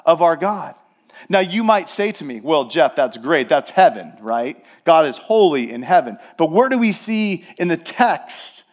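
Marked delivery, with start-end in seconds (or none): 2.4-6.2 lightly voiced listener in a lower pitch; hint of a sceptical churchgoer, pitched down; casual objector. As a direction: drop low for an offhand, mild objection.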